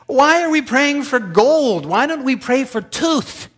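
incredulous question. Retold or imitated. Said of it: "then I was thinking".

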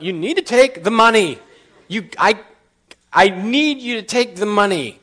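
pleading insistence. Retold or imitated. Insisting desperately.

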